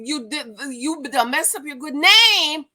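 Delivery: usual speaking voice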